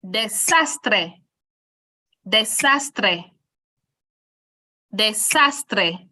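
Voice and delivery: desastre with SAS punched on a finger snap; stress on second-to-last syllable. Shown as a demonstration. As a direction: punch SAS in desastre, same each time. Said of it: "let's try the next one".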